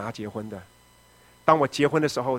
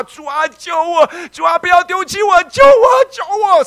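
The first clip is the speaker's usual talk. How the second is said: hoarse-edged desperate shout to God, high and loud; desperate crying out; 13 dB louder, slower than usual. Retold or imitated. Kneeling at the cross begging God for rescue, desperate shout. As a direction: scream the prayer at full force, slow and pleading. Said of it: "声嘶力竭的喊".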